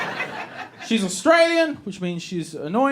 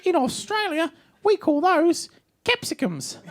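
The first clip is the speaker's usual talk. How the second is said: Australian wife; 11 semitones higher than usual. Retold or imitated. Wife corrects husband's word for peppers, mock Australian wife. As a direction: mimic a patronising Aussie wife, pitched right up. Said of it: "And she'll be like".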